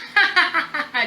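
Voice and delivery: put-on high, loud childish laughter; boy's laugh. Little boy laughing at the emperor, high and loud. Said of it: "The little boy laughed".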